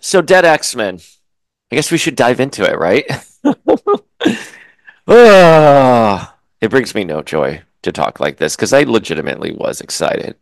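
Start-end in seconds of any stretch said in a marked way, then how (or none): 3.4-4.0 high laugh. Real, not acted. Laughing at own remark. 5.1-6.4 weary sigh. Real, not acted. Sigh before a complaint.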